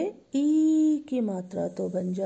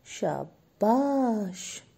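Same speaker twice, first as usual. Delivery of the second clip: drawn-out praise; much slower than usual. Real, not acted. Teacher praising class.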